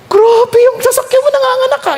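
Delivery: high, amazed exclamation; joking friend marvels at the multiplying cars, high and amazed. Retold or imitated.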